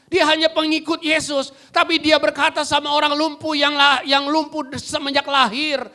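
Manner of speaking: loud, high, driving sermon voice; full-force preaching. Acted. Preacher rousing the church, loud and high. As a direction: preach it out to the congregation.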